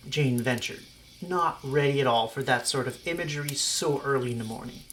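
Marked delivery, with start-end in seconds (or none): none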